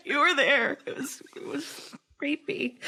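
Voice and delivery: high, breaking into real laughter; laughing. Real, not acted. Survivor playing along with therapy joke, laughing. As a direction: laugh through the words, voice fading away.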